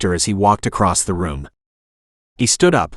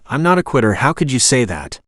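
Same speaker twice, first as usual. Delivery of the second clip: plain reading voice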